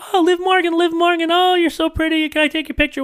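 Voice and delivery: high, squealing fangirl voice; mock the starstruck crowd; gushing fans. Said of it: "we hear a bunch of people go".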